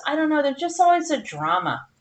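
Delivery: ordinary talk, nothing marked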